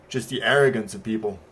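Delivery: ordinary talk, nothing marked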